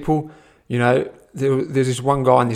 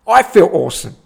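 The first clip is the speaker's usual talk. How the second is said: louder, higher awesome; excited; 5 semitones higher, 7 dB louder than usual. Real, not acted. Telling the viewers how good it felt, louder. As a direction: beam it out, louder. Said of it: "repeated louder".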